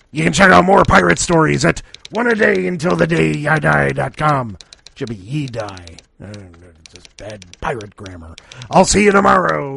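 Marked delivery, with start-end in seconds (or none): 4.9-8.3 muttered under the breath; soft sheepish correction to himself; quiet aside. Real, not acted.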